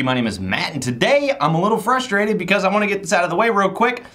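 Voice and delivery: ordinary talk, nothing marked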